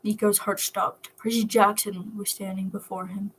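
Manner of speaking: ordinary delivery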